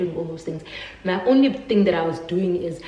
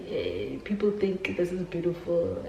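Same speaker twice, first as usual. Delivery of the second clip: mock cheer; 7 dB quieter than usual. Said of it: "and I was like".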